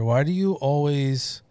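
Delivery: ordinary talk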